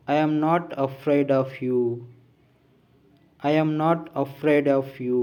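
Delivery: slow, deliberate drill delivery, each word set apart; measured, emphatic classroom read-out; language-lesson model reading. Shown as a demonstration. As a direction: read out each phrase firmly for learners to copy. Reads out model sentences for learners.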